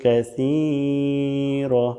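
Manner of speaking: slow, drawn-out Arabic chant; slow Quranic recitation. Acted. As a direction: recite the dua with long held vowels. Recites Arabic prayer slowly.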